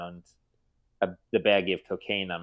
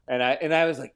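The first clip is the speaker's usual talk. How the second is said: ordinary delivery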